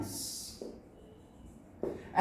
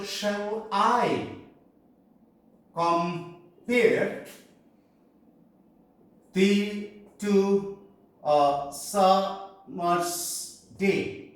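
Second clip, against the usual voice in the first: slow, separated metrical reading; measured line of verse, broken into parts; divided into feet; much slower than usual. Shown as a demonstration. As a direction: read it slowly, pausing between the feet. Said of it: "we can divide it into five".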